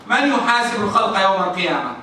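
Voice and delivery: normal talking voice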